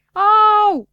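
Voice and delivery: high, loud ow; shout of pain. Acted. Dunno crying out as the doctor pulls the splinter, loud. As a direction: yelp as the splinter comes out. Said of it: "strigă habar n-am".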